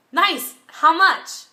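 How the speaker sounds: high, loud and eager; keen admiration. Acted. Shopper trying on a ring at a street stall, keenly. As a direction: pipe up brightly to the seller.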